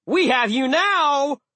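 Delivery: high, loud, stretched-out taunt; exultant slow battle cry; triumphant yell. A narrator's reading. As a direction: yell it at a cornered enemy. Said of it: "Jack yelled".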